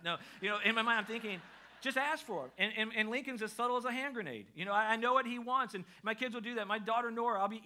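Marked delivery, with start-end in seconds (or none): 1.8-2.5 higher, pleading urgency, mildly hammed-up; exasperated inner thought. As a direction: burst out with the silent plea. His exasperated thought about son.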